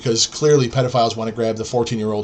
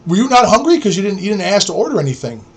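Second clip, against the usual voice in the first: high-pitched mock concern; feigned innocence; 6 semitones higher than usual. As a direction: imitate a schemer asking innocently.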